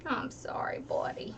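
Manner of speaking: hushed, gentle reassurance; soft soothing whisper. Real, not acted. Mom comforting hurt son.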